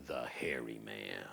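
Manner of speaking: slow, low, hushed villain introduction; ominous reveal. A narrator's reading. Storyteller revealing the monster to children, ominous. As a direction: draw out the name, low and soft.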